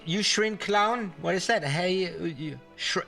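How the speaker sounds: ordinary talk, nothing marked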